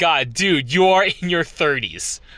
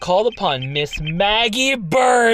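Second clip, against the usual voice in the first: higher, louder proud reveal; hammed-up prosecutor flourish; grand announcement; 8 semitones higher, 4 dB louder than usual.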